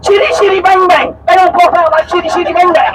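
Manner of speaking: high, mock-exasperated tourist; exasperated explaining. Lady explains tires to clueless captain, exasperated explaining. Acts lady's exasperated film comparison.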